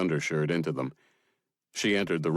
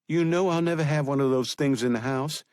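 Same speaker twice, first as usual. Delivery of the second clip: high, louder woman's voice; sister's voice; 9 semitones higher, 4 dB louder than usual. Acted. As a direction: voice the sister, firm and protective.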